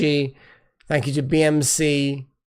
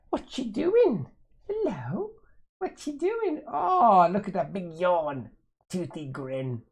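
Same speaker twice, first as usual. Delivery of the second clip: high, soft cooing pet voice; 4 semitones higher, 4 dB quieter than usual. Real, not acted. Baby-talking to the moving cat.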